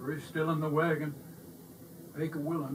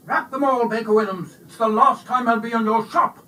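loud, high indignant orders; angry; 5 semitones higher, 10 dB louder than usual. A narrator's reading. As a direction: snap the orders in a huff. Bully storms off angrily.

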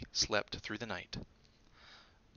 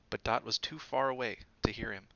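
ordinary delivery

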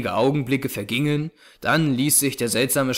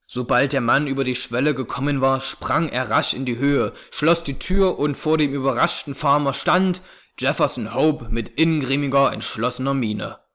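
plain reading voice

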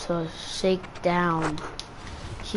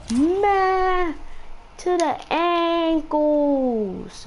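hyped drawn-out call; 7 semitones higher, much slower than usual. Real, not acted. Hyping an ankle-breaker move.

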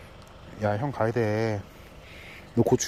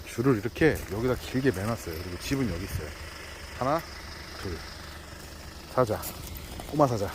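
ordinary talk

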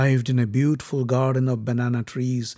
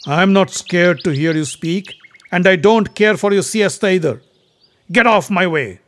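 defiant; 4 semitones higher, 7 dB louder than usual. Acted. Bhima confronts the monkey.

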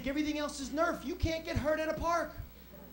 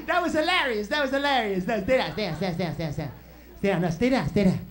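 loud rapid repeats, sinking in pitch; giddy, then urgently order everyone down; gleeful urgency; 5 semitones lower, 6 dB louder than usual. Retold or imitated. Brother ducking in the car after the prank, gleeful urgency.

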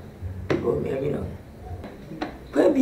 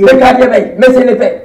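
loud retort; 7 dB louder than usual. Acted.

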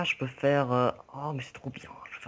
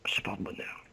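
whispering; 4 semitones lower, 6 dB quieter, slower than usual. Real, not acted. Whispered joke.

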